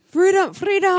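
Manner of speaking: high, loud Scottish battle cry; hammed-up Scottish rallying yell; Scottish accent. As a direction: shout it in a Scottish accent like Braveheart. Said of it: "I can always do Scottish".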